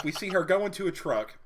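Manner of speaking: ordinary talk, nothing marked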